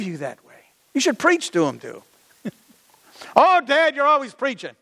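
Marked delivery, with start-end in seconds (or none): 3.3-4.8 high, louder whine of protest; mock exasperated kid; complaining child. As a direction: protest like a kid sick of Dad's sermons.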